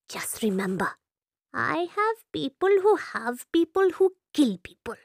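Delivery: put-on hushed threat from an old madam; menacing whisper. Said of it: "grabs hold of Ram ... and whispers".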